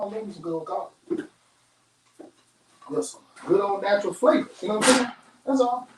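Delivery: ordinary talk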